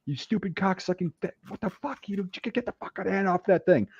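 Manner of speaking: high, held-back mock scream; imitated screaming. Drill sergeant in recruit's ear at fire alarm, mock screaming. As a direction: imitate a raging drill sergeant at reduced volume. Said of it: "screaming at the top of his lungs".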